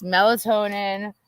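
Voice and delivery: ordinary talk, nothing marked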